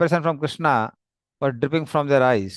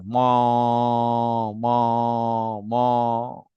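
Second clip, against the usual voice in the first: low, drawn-out mooing of scared cows; frightened cow mooing; 5 semitones lower, much slower than usual. Acted. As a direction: moo like a cow caught in fire. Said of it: "crying in fear".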